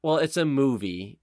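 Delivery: ordinary talk, nothing marked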